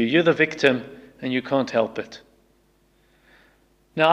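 ordinary delivery

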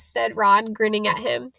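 ordinary delivery

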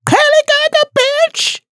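put-on very high, fast furious screech in a thick Xhosa accent; Mihlali. Imagined Mihlali beating someone up in the office, high angry screech. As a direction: imitate a woman screeching mid-brawl. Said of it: "Mihlali losing her marbles".

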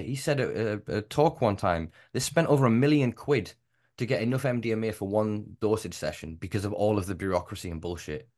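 usual speaking voice

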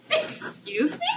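very high, taken-aback question; incredulous. Retold or imitated. Daughter retelling her reply to mom's iguana plan, incredulous. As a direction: squeak it out in mock disbelief. Shocked reply to mother's demand.

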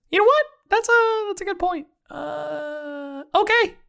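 very high, squeaky cartoon voice; accidental falsetto character voice; Mickey Mouse. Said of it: "I sound like Mickey Mouse".

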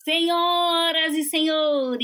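slow, booming circus announcer call; ringmaster. Pretend. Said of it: "O respeitável público é todo de vocês".

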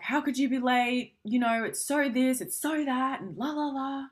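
half-hearted mock telling-off; a partner scolded for being late; mock yelling. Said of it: "we yell at them".

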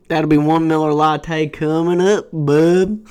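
loud mock Southern call. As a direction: call the order out with a drawl. Acting redneck barista.

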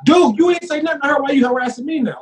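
high-pitched, aggrieved challenge; retold outburst at being singled out, voice shooting up; indignant protest. Acting his argument with cop.

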